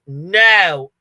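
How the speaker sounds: loud, irritated imitated no; retold exasperated denial; emphatic mock denial. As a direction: blurt it as the fed-up husband. Husband's third, emphatic denial.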